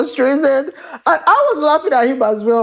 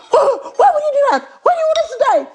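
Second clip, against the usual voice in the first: high mock-whiny complaint; high, whining imitation of an upset partner; imitating upset husband; faster than usual. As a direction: mimic him as a fussing, upset man. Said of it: "He was like".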